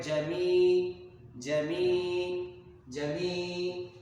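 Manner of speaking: جمی with the ی held out a full beat; long ya. Shown as a demonstration. Teacher drags out جمی to show madd. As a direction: stretch the ی in جمی to one alif. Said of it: "ایک الف لمبا کریں گے".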